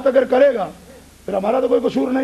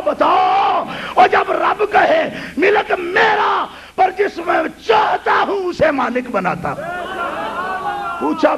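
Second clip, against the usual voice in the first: fiery preaching; 6 semitones higher than usual. Acted. Preacher shouting at the congregation in full force. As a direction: hammer it home to the crowd.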